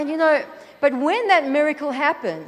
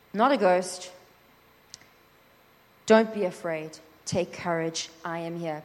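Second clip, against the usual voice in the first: lower, gentle and fading softer; calm reassurance; 7 semitones lower, 3 dB quieter than usual. Acted. Jesus calming Peter in the storm, low and gentle. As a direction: soothe the frightened disciples.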